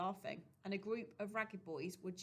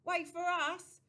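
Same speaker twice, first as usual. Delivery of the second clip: loud, higher street call; 10 semitones higher, 9 dB louder, slower than usual. A narrator's reading. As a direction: boys hailing a friend. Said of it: "they shouted".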